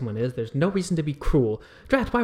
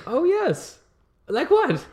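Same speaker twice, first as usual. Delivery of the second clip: a much higher, airy noble lady; haughty lady; 13 semitones higher, slower than usual. Acted. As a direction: drawl it like a bored aristocrat. Plays Lady Fantasia.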